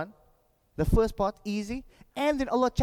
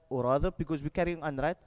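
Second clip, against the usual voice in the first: ordinary talk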